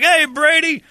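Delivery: high, loud put-on hello; greeting back. Brenly answering Brady, high loud greeting. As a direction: answer with a big friendly hey.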